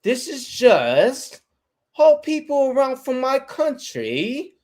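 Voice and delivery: high, slow, swooping mock British woman; exaggerated British accent. Showing how British women talk, extra singsong British. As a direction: overdo a singsong British accent, stretching the words. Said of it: "you don't really sound that much like it".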